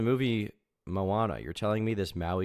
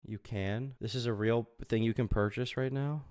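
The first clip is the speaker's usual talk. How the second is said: normal talking voice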